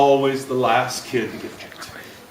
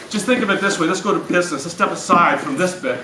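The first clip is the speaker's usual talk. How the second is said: ordinary talk, nothing marked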